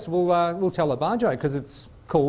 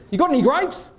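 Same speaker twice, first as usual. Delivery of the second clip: cheeky punchline; 9 semitones higher, 3 dB louder than usual. Acted. Duck springs the punchline on bartender.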